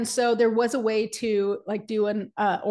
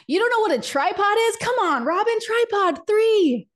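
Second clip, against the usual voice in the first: much higher and louder, mock disbelieving mother; incredulous mom; 11 semitones higher, 4 dB louder than usual. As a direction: drag out tripod three like an exasperated mom. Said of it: "my mom looked at me and she goes".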